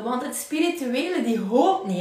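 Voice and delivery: usual speaking voice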